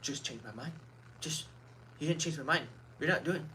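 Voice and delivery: mock a calm, unbothered debater baiting you, quietly; smug mock taunt. Streamer imitating a debater taunting an angry visitor, soft smug mock. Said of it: "they'll sit there and be like".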